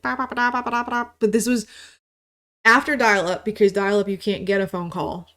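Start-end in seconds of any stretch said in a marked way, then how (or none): 0.0-1.1 sung-out ba da chime, higher; imitated ringtone chime. Imitating the old speaker buzz before a call. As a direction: mimic the speaker chime before the phone rang. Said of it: "it would be like".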